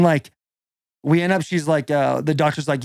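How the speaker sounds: usual speaking voice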